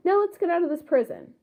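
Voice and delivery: a read-aloud yell, lightly voiced. A narrator's reading. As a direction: call out the escape plan, understated. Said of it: "yelled Saturn".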